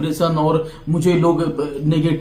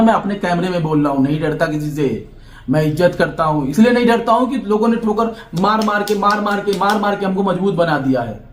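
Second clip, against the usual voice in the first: ordinary talk, nothing marked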